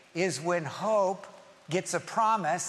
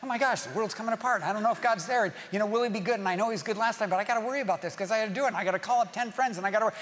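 fast, run-on fretting; anxious worrying; 2 semitones higher, much faster than usual. Acted. Anxious believer fretting that the world's coming apart, hurried. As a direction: rattle off the worries without stopping. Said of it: "to be anxious".

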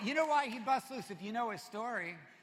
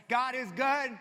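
loud high God is good, congregant style; shout; 5 semitones higher, 11 dB louder, slower than usual. Retold or imitated. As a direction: answer back like a church member. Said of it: "Maybe somebody will say".

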